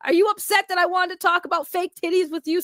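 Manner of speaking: ordinary talk, nothing marked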